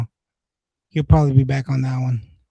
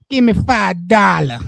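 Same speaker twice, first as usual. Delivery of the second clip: slow, higher mock demand; retold panhandler; 4 semitones higher, 3 dB louder, slower than usual. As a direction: imitate a pushy beggar, drawing it out. Voices the man begging.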